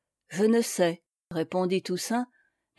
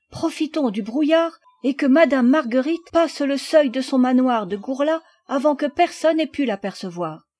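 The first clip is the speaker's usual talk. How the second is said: put-on higher, urgent young lad; eager boy; 4 semitones higher, 4 dB louder than usual. A narrator's reading. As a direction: press the plan in a raised boyish voice. Boy Noël urges haste.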